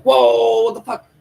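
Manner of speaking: shocked. Acted. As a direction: recoil at the sight. They see him being strangled.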